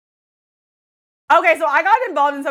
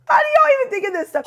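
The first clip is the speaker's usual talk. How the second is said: much higher, fast amused squeal; laughing squeal; 6 dB louder, faster than usual. Real, not acted.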